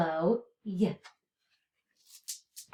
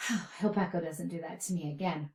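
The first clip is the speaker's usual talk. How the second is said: sighing aside; 7 semitones lower, 9 dB quieter, faster than usual. Acted. Sighing aside about puppet.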